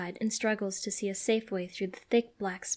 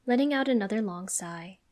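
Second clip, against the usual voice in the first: ordinary delivery, nothing marked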